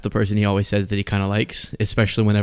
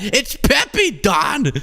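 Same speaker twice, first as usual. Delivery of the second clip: mock yell; 9 semitones higher, much slower than usual. Mock aide shouting to Trump.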